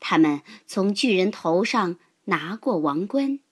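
ordinary delivery, nothing marked

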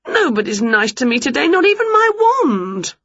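complaint climbing very high; self-pitying wail. Acted. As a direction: whine upward as if everyone is against you. Winnie wails in self-pity.

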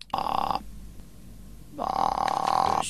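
croaky, frog in the throat; barely any voice left, croaking; hoarse throat. Acted. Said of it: "嗓子病得不轻啊，听起来像有只青蛙在里面".

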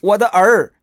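high, loud, smug taunt; cocky Monkey King style. Acted. Bajie taunting the demon, smug high Monkey-King voice. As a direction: crow it like the cheeky Monkey King. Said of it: "这话就是跟孙悟空学的".

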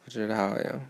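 ordinary delivery

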